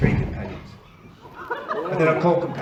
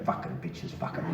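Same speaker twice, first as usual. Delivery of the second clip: muttered under breath; 9 dB quieter than usual. Retold or imitated.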